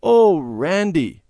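high, loud, drawn-out exclamation; dismayed exclamation. A narrator's reading. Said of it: "Mother exclaimed".

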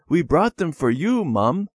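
high, smiling offering. A narrator's reading. Children giving Mum flowers and a rock, smiling. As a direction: beam as you hand over the gift. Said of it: "they said, smiling".

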